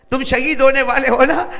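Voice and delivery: mother's words to her son, cried out to the crowd; loud fervent repeat. Acted. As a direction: cry the line out the second time.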